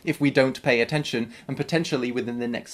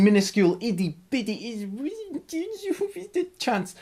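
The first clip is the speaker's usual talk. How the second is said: tiny squeaky voice; 8 semitones higher than usual. Acted. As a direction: voice shrinking up into a squeak. Exaggerating how tiny the chance is.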